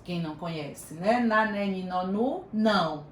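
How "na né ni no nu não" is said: Na, né, ni, no, nu, não recited syllable by syllable; syllable family drill. Shown as a demonstration. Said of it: "a do N". (recitando Na, né, ni, no, nu, não)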